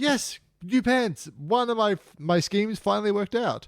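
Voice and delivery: high-pitched, drawn-out victory cry; mock triumph. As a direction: crow over a scheme finally paying off. Voices celebration the character never gave.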